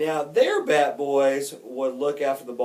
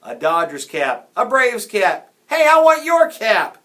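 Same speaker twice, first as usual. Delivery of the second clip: loud cries building higher and louder; kids shouting; 9 semitones higher, 14 dB louder than usual. A narrator's reading. Kids in the stands beg for souvenirs, shouting ever louder. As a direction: clamour for it, topping each shout. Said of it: "Jack ignored the kids shouting".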